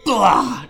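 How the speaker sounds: retching blah; mock puke noise. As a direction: fake a loud retch. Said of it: "acting out puking".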